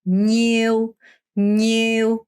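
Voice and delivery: "뉴" with the tongue gliding on the palate; teacher's palatal "뉴"; pronunciation drill. Shown as a demonstration. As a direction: model "뉴" for the class. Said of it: "뉴 이렇게 발음하셔야 돼요".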